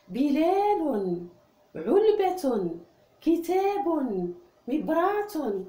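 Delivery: high and loud, word by word; loud classroom read-aloud; loud teacher reading. Shown as a demonstration. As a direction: call the words out so the whole class hears. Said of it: "أقرأ الكلمات التي فيها حرف الياء".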